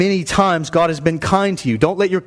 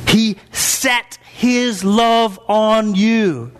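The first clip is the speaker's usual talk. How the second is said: very slow, higher, lingering; slow emphasis; 5 semitones higher, much slower than usual. Acted. Pastor dwelling on God's love for the church, very slow. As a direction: stretch every word out. Preacher savours the key line.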